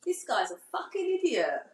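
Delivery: high-pitched, exaggerated Essex accent. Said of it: "I used to be like".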